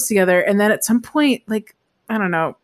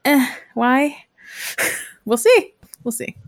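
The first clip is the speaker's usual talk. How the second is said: unimpressed; 4 semitones higher, slower than usual. Retold or imitated. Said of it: "To which I go".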